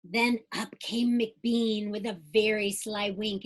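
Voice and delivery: lively rhyming storybook read-aloud; bouncy Dr. Seuss-style read-aloud; reading to children. Acted. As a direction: read it like bedtime story time for kids. Reads picture book aloud.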